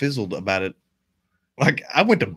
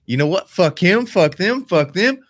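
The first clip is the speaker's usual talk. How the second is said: pumped-up defiance; 5 semitones higher, 4 dB louder, slower than usual. Retold or imitated. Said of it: "you'll just be like".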